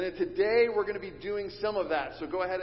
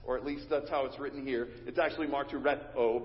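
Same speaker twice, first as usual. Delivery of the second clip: usual speaking voice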